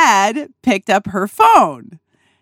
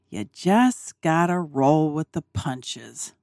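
slow, softer, musing repetition; reflective murmur; 5 semitones lower, 7 dB quieter than usual. Retold or imitated. Sitting alone in the dinette pondering dad's words, slow and soft. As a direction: turn the words over slowly to yourself. Thinking about what he said.

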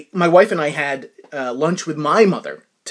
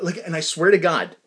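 ordinary delivery

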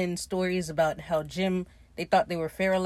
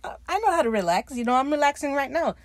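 higher-pitched retold protest; mock defensive; 7 semitones higher, 3 dB louder, faster than usual. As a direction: imitate an indignant, touchy comeback. Defensive retort to being called tense.

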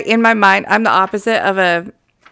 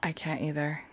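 breathy whispered reply; whispering; 5 semitones lower, 14 dB quieter than usual. Real, not acted. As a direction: answer in a whisper.

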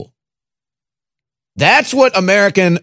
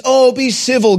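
mocking whine; 8 semitones higher than usual. Mocking quote.

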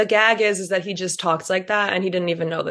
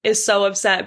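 normal talking voice

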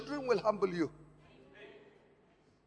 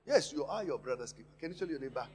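ordinary talk, nothing marked

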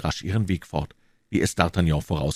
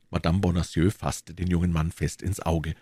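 ordinary delivery, nothing marked

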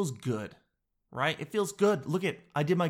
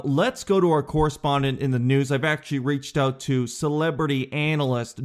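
normal talking voice

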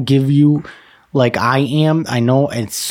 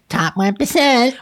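high, slow put-on brag; mock boasting; 11 semitones higher, slower than usual. Imagining his OnlyFans bio, high drawn-out mock boast. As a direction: draw out the boast in a high silly voice.

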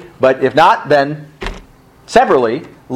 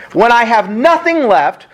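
usual speaking voice

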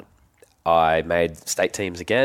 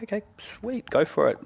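mum's breezy reply. Retold or imitated. Mum's easygoing reply retold.

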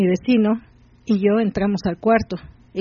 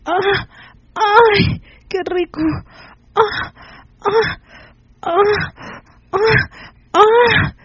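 very high, sharp cries of pleasure; loud cries; 12 semitones higher, 3 dB louder, much slower than usual. A narrator's reading. Rosa crying out loud in very high yelps. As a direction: let out high yelps, louder at the peaks. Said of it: "Rosa solo gritó fuerte".

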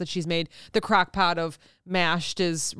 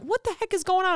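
baffled; 11 semitones higher than usual. Retold or imitated. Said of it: "I'm like".

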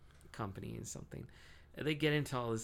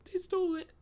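panicked squeak; 9 dB louder than usual. Retold or imitated. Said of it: "He's like".

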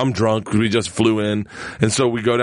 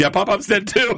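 laughing; 9 semitones higher than usual. Retold or imitated. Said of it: "they're like".

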